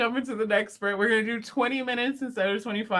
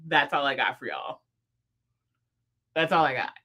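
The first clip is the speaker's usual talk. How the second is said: usual speaking voice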